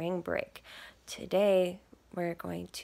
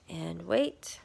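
ordinary delivery, nothing marked

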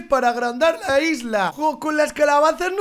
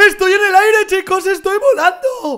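higher, louder thrilled cry; amazed to find himself flying; gasping with excitement; excited surprise; 4 semitones higher, 5 dB louder than usual. Real, not acted. Suddenly flying in the game.

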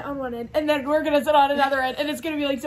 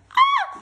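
high squeal of excitement; excited squeal; 5 dB louder than usual. Real, not acted. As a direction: let out a thrilled shriek. Friends about to arrive.

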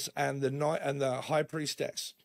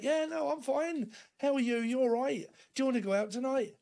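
much higher, quick and bubbly; usual cheery self; 9 semitones higher than usual. Retold or imitated. The friend's usual self, chirpy and upbeat to you. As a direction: breeze through it like the happy friend you normally are. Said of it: "You're normally like".

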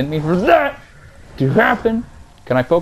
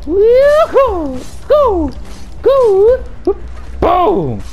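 excited cheering; much slower than usual. Real, not acted. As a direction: cheer like you just won. Cheering during combat.

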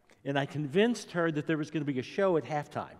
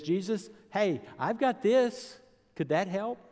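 put-on a small boy's eager offer, much higher and a bit louder; eager little boy; 8 semitones higher, 4 dB louder than usual. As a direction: hold up your lunch like a keen kid, voice pitched way up. Little boy offers his lunch.

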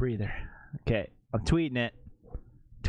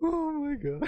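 high, giggling through the words; can't stop laughing; 3 dB quieter than usual. Real, not acted. Laughing fit.

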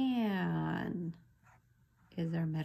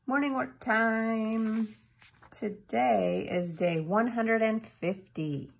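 announcing; 4 semitones higher, 7 dB louder, slower than usual. Acted. Teacher announces next activity.

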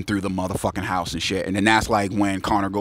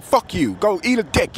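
high-pitched retold taunt; mock defiant yell; 10 semitones higher than usual. People inside refuse to open the door, mock defiant. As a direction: act out a cocky brush-off.

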